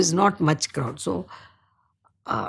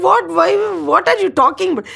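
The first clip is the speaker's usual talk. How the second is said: high, loud mock disbelief from the mother; mother blurting out her astonishment; surprised mother; 9 semitones higher, 5 dB louder, faster than usual. Mother baffled by child's news.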